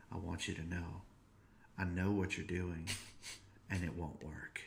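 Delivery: soft, unvoiced whisper; whispered warning. Dad whispering a warning to his son at bedtime. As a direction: breathe a quiet warning into his ear. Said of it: "I whispered in his ear".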